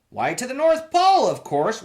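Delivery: as if it were obvious; cheery conductor. A narrator's reading. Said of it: "was his answer".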